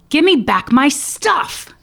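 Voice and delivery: high, loud, drawn-out demand in a put-on accent; agitated accented demand. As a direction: play an agitated Jesus demanding it back. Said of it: "he said it with an accent".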